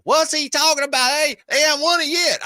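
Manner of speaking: high, mocking Dallas fan in a Texas accent. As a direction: lampoon an outraged Texas fan. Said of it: "Is that your Boston accent?".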